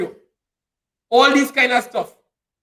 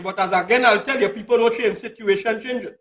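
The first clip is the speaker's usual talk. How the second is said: ordinary talk, nothing marked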